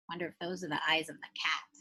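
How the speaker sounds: hushed whisper, quick and secret; soft, fast whispered aside. Real, not acted. Aside while showing a picture.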